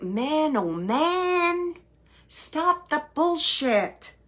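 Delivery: high, drawn-out exasperation; exasperated quote. Retold or imitated. Medium quoting spirit to the sitter, exasperated. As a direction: stretch it out like a weary scolding. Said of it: "that's exactly a quote".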